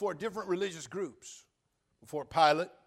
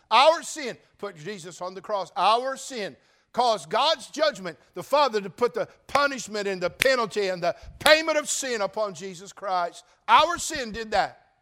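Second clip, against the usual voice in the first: louder, higher, driving sermon climax; full-force preaching; 6 semitones higher, 12 dB louder than usual. Real, not acted. Pastor pressing the congregation, full-force preaching louder and higher.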